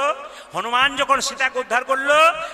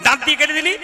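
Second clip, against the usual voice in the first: higher, loud angry mother scolding; Sita shouts; 5 semitones higher, 4 dB louder than usual. Acted. Sita rebukes Hanuman for biting her necklace, loud high shout. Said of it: "Sita চিৎকার করে বলল".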